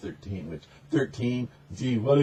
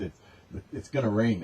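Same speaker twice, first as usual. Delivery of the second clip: usual speaking voice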